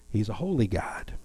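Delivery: soft reverent whisper; hushed, reverent whisper. Real, not acted. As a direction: breathe it out in awe. Hushed aside after the laugh.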